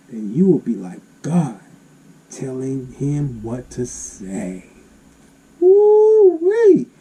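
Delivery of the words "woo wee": excited holler. Real, not acted. Preacher's excited exclamation.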